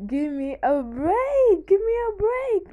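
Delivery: high, loud, slow pleading whine; mock exasperated plea. Retelling what she told her partner, mock pleading. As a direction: beg him for a break, hammed up. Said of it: "and I was like".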